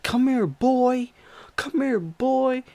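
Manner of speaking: high, loud, drawn-out yell; slow high-pitched crazed shout, mimicked; imitated screaming pursuer. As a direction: holler after someone you are chasing. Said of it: "And he's screaming".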